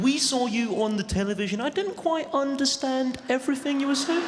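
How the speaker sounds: high, prim English accent mocking a Scot; posh English mimicry, lightly polite. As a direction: do a plummy southern English lady, puzzled. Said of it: "somebody from Leamington Spa saying".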